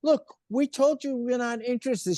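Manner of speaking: high-pitched mock exasperation; exasperated rebuff. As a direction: imitate executives fed up with her. Acted exasperated Sony rejection.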